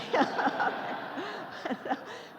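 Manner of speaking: high burst of real laughter; laughing. Real, not acted. Lecturer admits the computer did the counting, laughing. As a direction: laugh out loud at your own joke. Laughs after her own joke.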